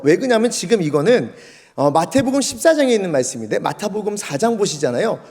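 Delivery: usual speaking voice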